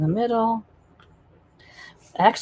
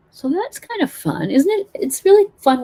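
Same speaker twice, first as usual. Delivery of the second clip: delighted; 11 semitones higher, 7 dB louder than usual. Real, not acted. Delighted with her painting.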